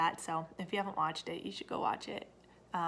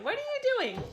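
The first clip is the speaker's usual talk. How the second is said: high-pitched call; 4 dB louder than usual. Real, not acted. Mother calling to climbing toddler.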